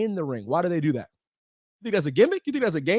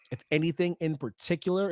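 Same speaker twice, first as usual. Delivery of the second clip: normal talking voice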